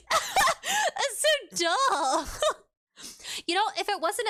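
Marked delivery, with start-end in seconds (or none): 0.0-2.4 high, slow, laughing shout. Real, not acted. Laughing at her own silly idea, laughing shout.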